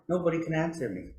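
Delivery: plain reading voice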